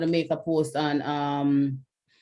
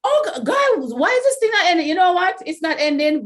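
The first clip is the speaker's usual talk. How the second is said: much higher, louder flustered complaint; exasperated high laugh-talk; 12 semitones higher, 6 dB louder than usual. Real, not acted. Host flustered that the webinar won't close, higher and louder.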